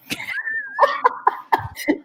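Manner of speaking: squealing high laugh. Real, not acted. Laughs at her own bragging.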